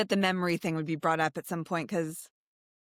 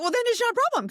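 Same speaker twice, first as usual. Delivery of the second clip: high, loud mock retort; mock dismissive; 14 semitones higher, 6 dB louder, much faster than usual. Host mimics senators waving her answer away, mock dismissive.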